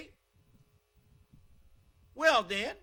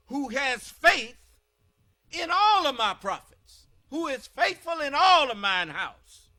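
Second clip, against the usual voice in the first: louder, higher, slower scripture reading; booming preaching; 5 semitones higher, 6 dB louder than usual. Acted. Preacher reading God's words to the congregation, booming.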